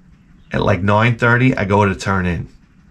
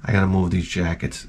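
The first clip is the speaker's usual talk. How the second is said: mock dismay; 5 semitones lower, 6 dB quieter than usual. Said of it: "So I'm like".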